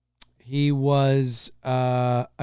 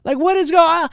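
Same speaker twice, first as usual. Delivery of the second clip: put-on baffled kid; 11 semitones higher, 3 dB louder than usual. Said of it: "I'm like".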